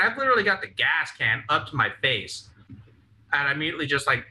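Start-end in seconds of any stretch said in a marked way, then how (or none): none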